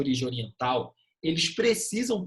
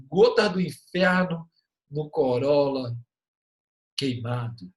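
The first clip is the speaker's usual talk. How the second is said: mock dramatic poem; 5 dB louder than usual. Joking student summer haikai.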